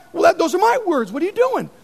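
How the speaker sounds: preacher imitating Buzz Lightyear upset at being quoted. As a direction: complain like an offended Buzz. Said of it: "He's like".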